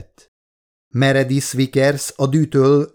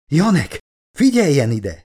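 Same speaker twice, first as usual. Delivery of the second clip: raised-pitch urgent call; crying out; 5 semitones higher than usual. A narrator's reading. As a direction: call out to get the captain's attention. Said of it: "kiáltotta ismét Elizabeth". (sürgetően felkiáltva)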